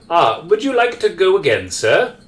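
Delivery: high-pitched, courteous butler; mid-century butler. Acted. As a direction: offer another ride like an old-fashioned butler. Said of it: "the butler says".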